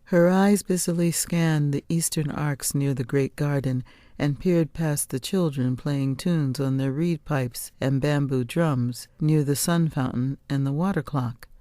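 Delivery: plain reading voice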